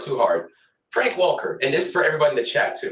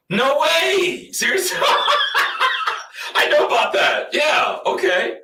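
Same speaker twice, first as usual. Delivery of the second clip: much higher, louder delighted squeal; excited outburst; 3 dB louder, slower than usual. Real, not acted. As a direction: burst out with laughing glee. Excited reaction to surprise guest.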